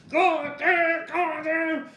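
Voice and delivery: very high, loud Acadian French swearing; Acadian French yell. Retold or imitated. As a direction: bellow the curses in a thick Acadian French accent. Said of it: "in his Acadian French accent, he would yell".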